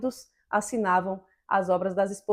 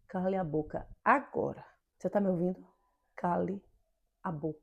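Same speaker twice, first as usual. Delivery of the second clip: low, very soft menace; hushed, controlled order with deliberate pauses; firm, calm intimidation; 4 semitones lower, 9 dB quieter than usual. Shown as a demonstration. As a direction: corner them without raising your voice. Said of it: "falar firme, calmamente, mas te acuar".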